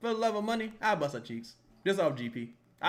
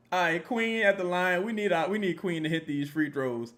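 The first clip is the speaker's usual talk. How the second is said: ordinary talk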